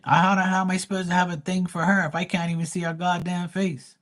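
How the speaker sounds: retold high-pitched indignant retort; exasperated disbelief. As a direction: pitch up in mock outrage at the absurd idea. Retold scornful reply to chat.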